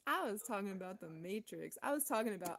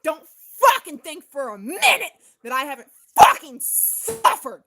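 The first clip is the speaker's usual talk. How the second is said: very loud, high, furious scream; furious yell; 5 semitones higher, 21 dB louder than usual. Real, not acted. Yelling at doubters that she has truly suffered, furious scream. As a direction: hurl it at the viewer in rage.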